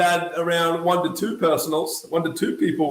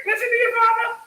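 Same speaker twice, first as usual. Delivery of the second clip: high, quick motherly voice; mum's voice; faster than usual. Retold or imitated. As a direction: back up the father, quickly. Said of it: "Mum's like".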